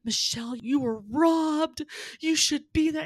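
imitating indignant fans; indignant patrons. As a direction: stretch out the outrage on their behalf. Patrons protesting the result.

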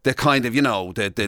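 normal talking voice

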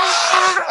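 very high mock scream; high-pitched "ah" of a celebrating crowd; imitated crowd scream. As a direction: scream like the whole room, in retelling. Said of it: "everybody was like".

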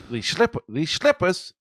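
high put-on Jersey accent. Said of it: "do a Jersey accent".

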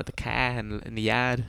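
quiet, low Boston "car" and "yard", no R's; Boston dropped R's. Acted. Recalling the Boston accent, R's dropped from "car" and "yard". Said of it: "they call it dropping R's".

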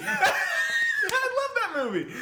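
high, drawn-out cry through laughter; laughing outburst. Real, not acted. Friends crack up over a movie guess, laughing outburst. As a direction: squeal it out while cracking up.

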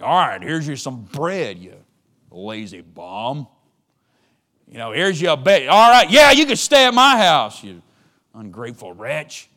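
loud, high, spiteful grumbling host; wicked stepmother. Acted. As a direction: spit it out with contempt. Grudging host insulting guest.

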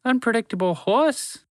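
high, slow squeaky disbelief; can't believe who it is; surprised mouse. Acted. Mouse startled to see the horse, high and slow.

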